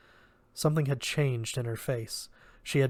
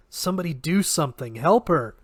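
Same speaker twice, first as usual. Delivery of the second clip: higher, louder, fast desperate cry; frantic call for help; urgent plea; 4 semitones higher, 5 dB louder than usual. Acted. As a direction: beg someone to act, rising to a shout. Desperate plea during fight.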